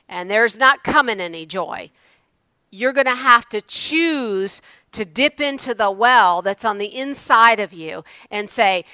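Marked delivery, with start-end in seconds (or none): none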